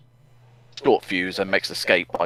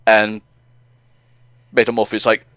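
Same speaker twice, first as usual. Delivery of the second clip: ordinary talk, nothing marked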